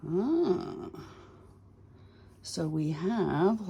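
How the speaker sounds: normal talking voice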